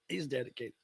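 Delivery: soft echoed He's dedicated; murmured echo. Shown as a demonstration. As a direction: murmur He's dedicated back under your breath.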